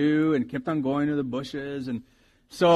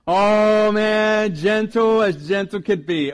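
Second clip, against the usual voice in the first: gushing; 6 semitones higher, 8 dB louder, slower than usual. Real, not acted. Gushing about riding horses.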